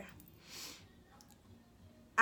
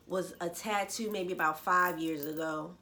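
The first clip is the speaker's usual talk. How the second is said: ordinary talk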